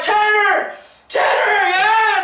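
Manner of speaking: high, loud holler; shrieked retold greeting; excited call. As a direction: shout to a friend across bleachers. Retold calling friend across bleachers.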